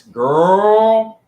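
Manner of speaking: put-on long, rising, loud camp "girl". Shown as a demonstration. Host announcing a put-on voice to viewers, camp drawl. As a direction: stretch it out in an exaggerated camp voice.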